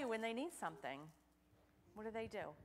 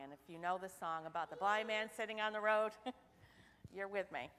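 normal talking voice

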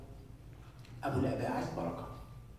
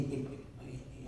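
slow quiet question; 11 dB quieter, slower than usual. Shown as a demonstration.

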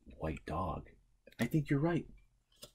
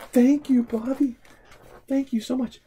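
much higher, louder thank-you; delighted thanks to Bobby for the guitar; excited thanks; 12 semitones higher, 7 dB louder than usual. Real, not acted. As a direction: gush gratefully. Receiving guitar.

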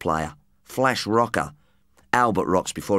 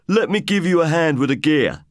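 imitated creep; 5 semitones higher than usual. Narrator mimics the stage-door creep, mock wheedling. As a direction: play the hopeful hanger-on fishing for work. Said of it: "You know".

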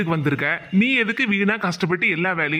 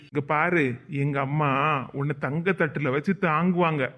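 ordinary talk, nothing marked

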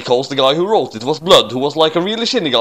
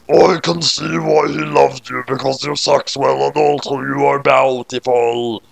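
slow, put-on monster voice; Shinigami king; slower than usual. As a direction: play the death god bargaining slowly.

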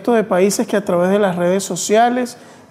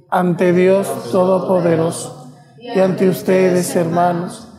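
cathedral echo. Real, not acted. Confiteor prayed in the chapel.

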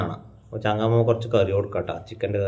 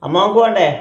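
raised, loud voice to be heard; loud calling; 9 semitones higher, 6 dB louder than usual. Real, not acted. Grandson to his hard-of-hearing grandma, loud calling. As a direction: call it out high so grandma hears.

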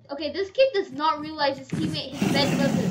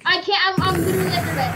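urgent excited; 6 semitones higher, 9 dB louder than usual. Real, not acted. Urgent reply under pressure.

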